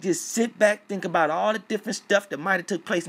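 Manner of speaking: loud, high-pitched heated tirade; worked-up shouting rant at a hacker; angry rant. Real, not acted. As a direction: go off on him, raising your voice.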